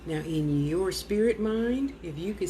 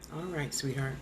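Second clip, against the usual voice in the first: much softer and lower goodbye; tender farewell; 6 semitones lower, 7 dB quieter than usual. Real, not acted. Medium bidding the spirit goodbye, softly tender. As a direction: let him go gently.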